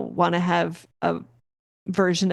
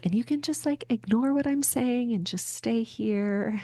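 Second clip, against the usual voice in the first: meditation voice; 4 semitones higher, 5 dB quieter than usual. Shown as a demonstration. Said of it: "my voice is going to be pretty".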